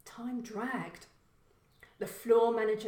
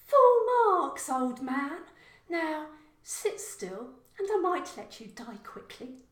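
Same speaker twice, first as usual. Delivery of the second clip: much higher, louder, mocking female voice; taunting killer; 8 semitones higher, 9 dB louder than usual. Santa slasher taunting the trapped Kevin, gloating. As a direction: gloat over him, slow on the threat.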